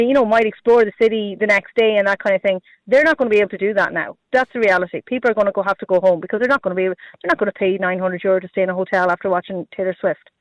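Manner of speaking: usual speaking voice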